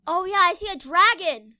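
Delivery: ordinary talk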